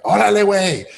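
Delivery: high, loud casual Mexican slang call; mock workman's holler; imitating leaf blower guys. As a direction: shout it like workers bantering. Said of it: "they were like".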